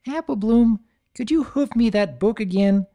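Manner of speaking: high, bright little-pony voice; filly calling up from the pit; young filly voice. Acted. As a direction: sound like an eager little filly.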